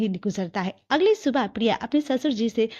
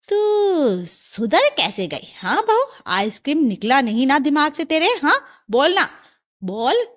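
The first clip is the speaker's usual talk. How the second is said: suspicious scolding; 4 semitones higher, 5 dB louder than usual. Acted. Mother-in-law interrogates daughter-in-law.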